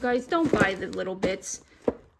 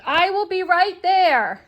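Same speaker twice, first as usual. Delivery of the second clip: loud, high, drawn-out call; 8 semitones higher, 7 dB louder, slower than usual. Real, not acted. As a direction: holler it to someone in another room. Calling out to someone off camera.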